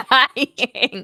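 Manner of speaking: laughing. Real, not acted.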